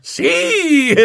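high, jubilant yes; delighted high outburst; exultant cry. A narrator's reading. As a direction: cheer in sudden triumph.

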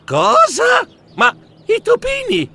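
high, loud shocked cries; panicked outcry. Acted. Mouse discovering he's signed up for the marathon, high panicked cries. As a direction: realise you have been tricked.

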